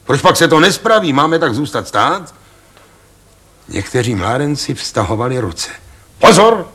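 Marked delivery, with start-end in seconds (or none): 0.0-2.3 fast and much higher, pressing; quick, high, impatient demand; impatient outburst. Acted. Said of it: "houkl v tom Ostrohradský". 6.2-6.7 very high, loud warning cry; full, ringing shout of alarm. Acted. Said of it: "vzkříkl ... hlasem k neuvěření širokým a zvučným".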